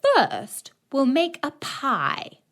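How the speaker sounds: high, slow witch voice; sly witch. A narrator's reading. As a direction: sweet-talk the girl. Witch speaks.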